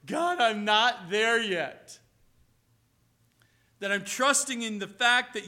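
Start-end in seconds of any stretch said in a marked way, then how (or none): 0.1-2.0 loud lament. Retold or imitated. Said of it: "I read this ... going".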